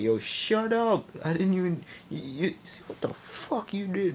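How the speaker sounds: high, exasperated mock outburst; Philip. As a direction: drag out an outraged shut up, then sputter. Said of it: "I started acting like Philip".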